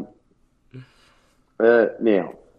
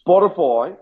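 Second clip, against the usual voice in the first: mock boast; 7 semitones higher, slower than usual. Mock boasting about records.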